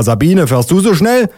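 high-pitched call to a colleague. Acted. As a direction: policeman calling over a question. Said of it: "ruft er".

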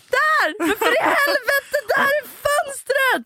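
high, half-laughing frantic yell; boyfriend yelling at pigeon. Retold or imitated. Boyfriend shouting at the pigeon to find the window, frantic yell. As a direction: imitate him yelling at the bird, voice cracking. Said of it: "jag hör hur han bara".

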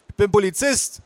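put-on high-pitched kid voice; small boy. Boy asked for ID, proud child voice. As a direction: declare it like a boy playing police.

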